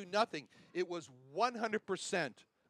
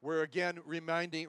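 normal talking voice

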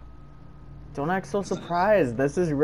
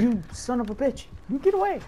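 high-pitched outburst; 9 semitones higher than usual. Real, not acted. Caught by killer, outburst.

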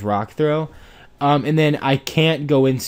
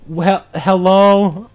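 slow stunned hello; 4 semitones higher, 5 dB louder, much slower than usual. Real, not acted. Disbelief at strong enemy.

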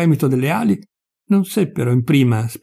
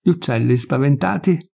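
ordinary delivery, nothing marked

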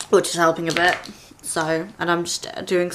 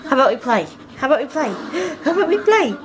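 playful pet talk; 7 semitones higher, much faster than usual. Real, not acted. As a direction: coax the dog into play, building up. Coaxing dog to play.

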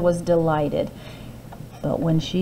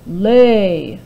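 booming giant's roar; roared command; 6 dB louder than usual. A narrator's reading. Giant roaring at his goose. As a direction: bellow it like a giant.

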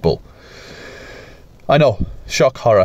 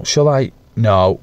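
ordinary talk

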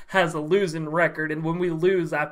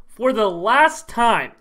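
normal talking voice

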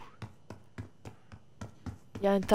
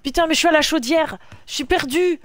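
loud frustrated complaint; 5 semitones higher, 7 dB louder than usual. Real, not acted. Lost in manor, complaining aloud.

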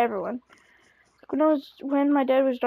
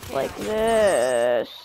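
croaky, drawn-out cracking voice; 3 semitones lower than usual. Shown as a demonstration. Player showing how his voice keeps cracking, voice cracking. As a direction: let the voice break and drag on the word. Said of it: "apologize if my voice keeps cracking".

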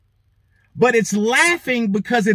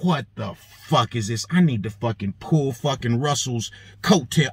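low, softer disbelief; indignant inner grumble; 8 semitones lower, 4 dB quieter than usual. Retold or imitated. Shopper brooding over Russell's scolding, quietly indignant. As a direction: grumble it to yourself, pitched down. Acting out private indignant thought.

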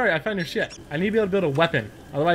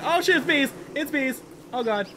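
panicked yelling; 8 semitones higher than usual. Real, not acted. Player fleeing a swarm of bees, panicked yelps. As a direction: yelp in real panic.

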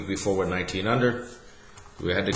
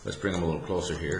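normal talking voice

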